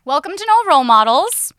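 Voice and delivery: loud upbeat host welcome; podcast intro. Acted. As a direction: greet the audience like a host. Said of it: "can you intro the podcast, please?".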